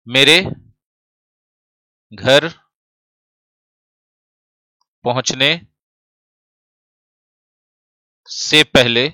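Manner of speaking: "मेरे... घर... पहुंचने..." with long pauses between words; word-by-word dictation. Shown as a demonstration. Teacher writing out a Hindi example for the class, word-by-word dictation. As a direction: break "मेरे घर पहुंचने से पहले बच्चे सो चुकेंगे" into slow dictation chunks.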